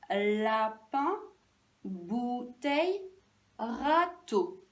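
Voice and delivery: "lapin, bouteille, râteau" slow, each first syllable held; first syllables stretched. Shown as a demonstration. Teacher recalling the pictures left, first syllables stretched. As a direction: draw out la, bou and râ. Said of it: "Et moi, je veux bou".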